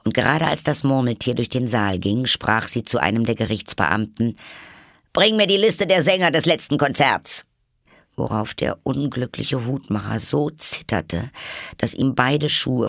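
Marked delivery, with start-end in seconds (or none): 5.1-7.4 high, loud imperious order; loud, high-pitched royal demand; commanding Queen. Acted. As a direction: command the court as an angry queen. Queen orders a court official.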